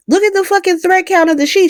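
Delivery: mock outrage at the sheets; incredulous. Real, not acted. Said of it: "outraged at fancy bed".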